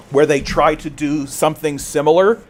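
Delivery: ordinary talk, nothing marked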